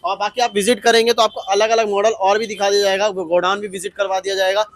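loud, upbeat showroom promo; promo outro. Acted. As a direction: push the showroom to viewers. Loud wrap-up promo to viewers.

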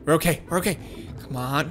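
low, soft nervous murmur; hushed. Real, not acted. Hiding from the baby, murmuring nervously. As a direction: keep it down so it won't hear.

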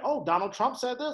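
imitating shocked people, high and loud; mock surprise. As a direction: play the astonished news-sharer. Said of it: "when people are like".

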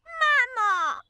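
high, drawn-out call for mum; upset wail. Acted. Child calls mum over a sunken watering can, upset high wail. Child wails for mother again.

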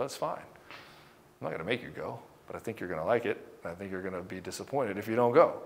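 husband gently coaxing his wife to come, soft; soft reassuring husband. Retold or imitated. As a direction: coax her gently, easy and low. Husband reassuring wife, acted quote.

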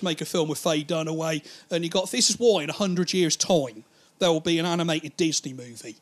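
usual speaking voice

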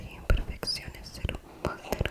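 ordinary talk

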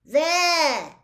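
loud, high shout; yell. A narrator's reading. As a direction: point and yell it out. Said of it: "yelled a sixth year".

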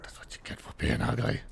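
soft whispered gloat; whispered taunt. Real, not acted. Gamer gloating over a dead grub, whispered. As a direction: whisper the insult.

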